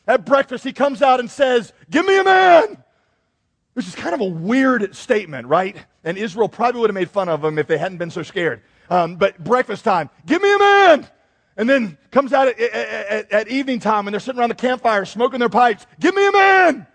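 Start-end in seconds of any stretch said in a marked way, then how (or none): 1.9-2.8 high, loud bellowing taunt; mock Goliath roaring a challenge; booming giant's challenge. Goliath taunting Israel's army. 10.2-11.1 high, loud bellowing taunt; mock Goliath roaring a challenge; booming giant's challenge. 16.0-16.9 high, loud bellowing taunt; mock Goliath roaring a challenge; booming giant's challenge.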